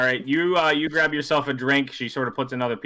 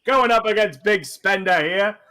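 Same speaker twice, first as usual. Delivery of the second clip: louder, higher hawker call; dealer calling bets; 7 semitones higher, 4 dB louder than usual. Acted. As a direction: rattle the dice and rally the table. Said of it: "starts shaking 'em around".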